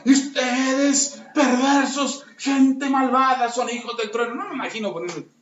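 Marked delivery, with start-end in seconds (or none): 0.1-4.4 loud, slow, booming scolding; mock thundering rebuke. Jesus scolding disciples, mock angry booming rebuke. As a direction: thunder at them like an angry preacher. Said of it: "yo no me imagino".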